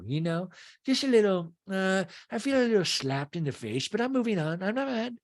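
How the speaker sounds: high put-on wounded voice; Meredith. Meredith feels slighted by Dana, high imitated hurt. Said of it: "And Meredith's like".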